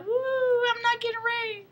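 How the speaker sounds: high, softening falsetto cheer; mock relieved squeal. Rescued girl celebrates that she is safe, high mock-relieved squeal.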